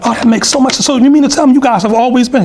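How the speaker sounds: high-voiced British lady impression; British accent. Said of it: "They had a British accent".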